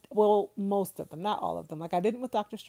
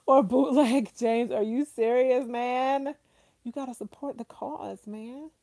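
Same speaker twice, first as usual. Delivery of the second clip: playful high-pitched scold of a friend; mock scolding; 7 semitones higher, 5 dB louder than usual. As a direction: tease him for bootlegging, half-serious. Mock scolding a viewer over bootlegs.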